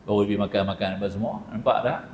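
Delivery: normal talking voice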